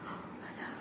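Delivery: breathless whisper; whispered I love you; whispered confession. Acted. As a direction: barely whisper it.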